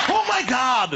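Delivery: high exclamation imitating sudden horror; mock shocked reaction. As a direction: burst out in exaggerated, late alarm. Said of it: "it's like, reaction".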